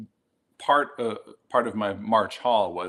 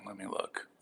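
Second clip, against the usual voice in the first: whispered to himself; 13 dB quieter than usual. Real, not acted. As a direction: breathe it under your breath. Muttering while checking camera settings.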